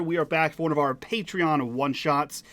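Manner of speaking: ordinary talk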